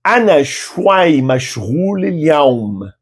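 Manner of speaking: "Ana shwai mashghul al-yawm" said very slowly, word by word; very slow teacher model; slow model phrase. Shown as a demonstration. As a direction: dictate it slowly for repeating. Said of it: "Repita depois de mim".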